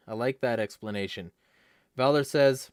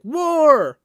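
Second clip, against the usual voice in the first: high, very loud, drawn-out bellow; mock war cry; 11 dB louder than usual. War hawks answering China's peace offer, mock war cry. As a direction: bellow it like a mindless hawk.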